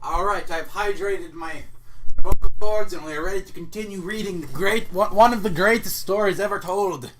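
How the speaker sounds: mock grand. Real, not acted. Reader back from water break, mock grandiose and loud. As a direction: puff it up like a pompous host. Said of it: "my brilliant, soothing billionaire voice".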